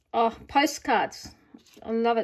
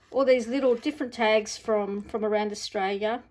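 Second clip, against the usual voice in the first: ordinary talk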